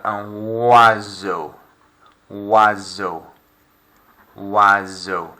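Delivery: oiseau slow, oi as wa, s like a z; French oiseau. Shown as a demonstration. Teacher models oiseau for pupils, slow. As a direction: say oiseau as wazo. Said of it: "That S is almost becoming like a Z".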